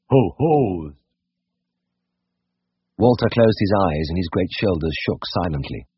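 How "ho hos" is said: restrained, dignified chuckle; dignified ho-hos. A narrator's reading. Brothers chuckle about Tristan in a dignified way. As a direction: laugh with dignity. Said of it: "a few dignified".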